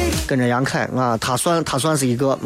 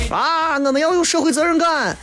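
mock grandstanding; 8 semitones higher than usual. Mocking moments posts.

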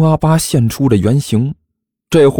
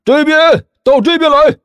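much higher call across the field. A narrator's reading. Teacher calling from afar.

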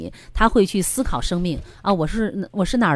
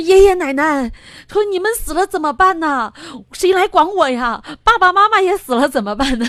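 put-on high, childlike pleading, louder; imitating a scared child; 10 semitones higher, 6 dB louder than usual. 侄子问爷爷奶奶死了谁来管他，高声模仿孩子的担忧. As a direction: voice a frightened little boy asking grandparents. Retold crying nephew.

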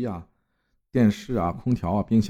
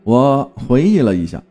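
louder, animated storytelling read with clear emphasis; story narration; 5 dB louder than usual. A narrator's reading. Host reading a listener's eerie hotel story to the audience, narrating. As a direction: read it out as a told tale, lifting each opening phrase.

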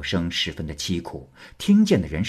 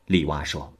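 ordinary delivery

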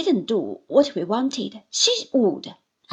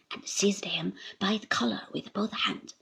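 ordinary talk, nothing marked

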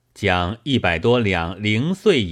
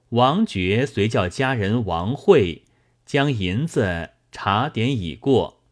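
ordinary delivery, nothing marked

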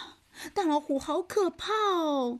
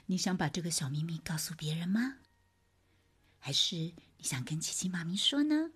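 whisper; 7 semitones lower, 4 dB quieter, faster than usual. Acted. Mother whispers secret to child.

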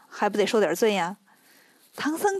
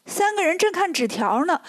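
ordinary delivery, nothing marked

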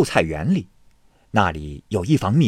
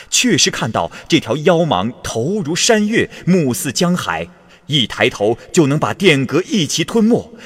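louder formal official's report, growing alarmed; urgent memorial read aloud; 3 semitones higher, 3 dB louder than usual. A narrator's reading. Minister's memorial urging the king to flee, loud formal alarm. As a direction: declaim it like a minister pleading disaster. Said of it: "奏章上写着".

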